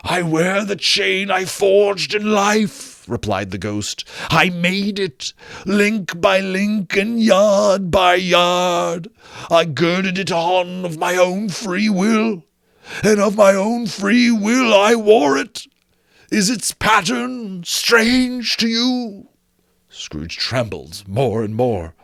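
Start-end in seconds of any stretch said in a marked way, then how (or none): 0.1-3.0 higher, slow wailing moan that swells loud; ghost lamenting. Acted. Marley's ghost explaining his doom, slow wailing ghost voice. 4.3-19.3 higher, slow wailing moan that swells loud; ghost lamenting. Acted. Marley's ghost explaining his doom, slow wailing ghost voice.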